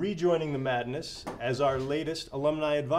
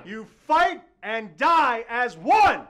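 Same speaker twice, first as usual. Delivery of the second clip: rallying shout; 7 semitones higher, 4 dB louder than usual. Acted. Rallying speech to brothers.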